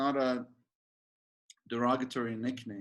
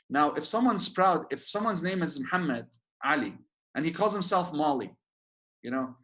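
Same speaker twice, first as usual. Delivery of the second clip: ordinary talk, nothing marked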